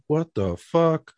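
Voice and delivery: slow, drawn-out what the fuck; incredulous. Retold or imitated. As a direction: stretch it out in disbelief. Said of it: "And I'm like".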